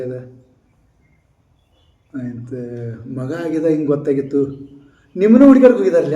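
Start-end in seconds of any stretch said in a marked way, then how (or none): 5.2-6.2 quick, raised, higher and louder asking; rushed surprised question; hurried excited question. Retold or imitated. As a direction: blurt it out fast in surprise. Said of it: "ಅಂತ ಅನ್ಕತ್ತಾರ".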